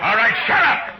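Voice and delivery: very loud, very high bark to silence the room; furious shout. Acted. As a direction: yell them down hard. Matt silences the bar crowd.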